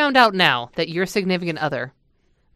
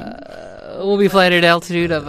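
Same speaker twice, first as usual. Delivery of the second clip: pilot cockpit headset. Pretend. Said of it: "this is your captain speaking".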